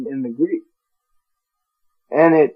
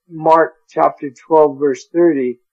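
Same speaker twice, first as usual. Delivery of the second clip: normal talking voice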